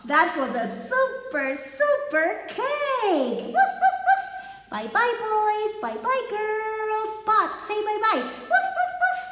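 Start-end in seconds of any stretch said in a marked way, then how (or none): 3.5-4.2 high, loud playful woof; puppy barking. Acted. Spot barking goodbye. 8.2-9.2 puppy barking. Acted. Spot barking goodbye.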